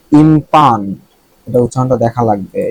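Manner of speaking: normal talking voice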